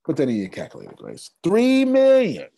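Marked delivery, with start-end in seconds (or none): 1.4-2.5 high, loud, slow burst of excitement; triumphant shouted figure; excited shout. Real, not acted. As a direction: belt out Three million, thrilled. Shouting huge money figure.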